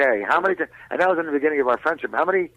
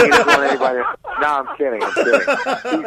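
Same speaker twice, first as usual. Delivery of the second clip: laughing; 11 semitones higher, 5 dB louder than usual. Real, not acted. Joking, laughing.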